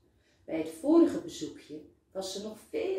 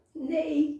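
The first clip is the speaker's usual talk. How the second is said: laughing; 9 semitones higher, 5 dB louder than usual. A narrator's reading. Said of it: "lacht Saar".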